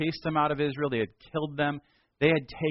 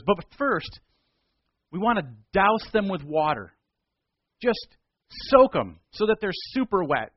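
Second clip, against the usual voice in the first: higher and louder, relishing the twist; gleeful challenge; 6 semitones higher, 5 dB louder than usual. Acted. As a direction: Elijah dares the prophets to soak the wood, gleefully. Elijah adds the water twist.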